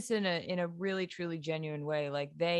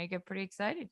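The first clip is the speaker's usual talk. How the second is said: usual speaking voice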